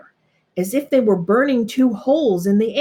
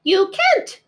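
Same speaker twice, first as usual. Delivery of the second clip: high, sharp retort; snapping rabbit; 12 semitones higher, 3 dB louder than usual. A narrator's reading. Rabbit snapping back at the bear. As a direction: cut him off. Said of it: "snapped the rabbit".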